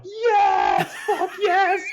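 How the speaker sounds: high, loud, thrilled yell; ecstatic yell. Retold or imitated. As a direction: scream it with total delight at the attack. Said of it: "And I'm like".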